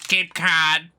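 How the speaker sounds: loud, high, exaggerated Boston accent. Shown as a demonstration. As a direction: lay the Boston accent on loudly. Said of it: "My Boston accent just slipped out".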